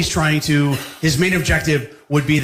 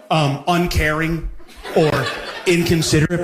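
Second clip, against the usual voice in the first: ordinary delivery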